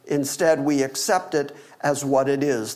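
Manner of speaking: usual speaking voice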